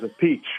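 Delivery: "a peach" run together, ending in a CH; Appice as a-PEACH. Retold or imitated. Drummer quotes brother's version of surname, "a peach" as a-PEACH. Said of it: "three different pronunciations".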